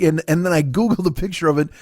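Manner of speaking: ordinary talk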